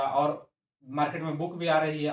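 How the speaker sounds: ordinary talk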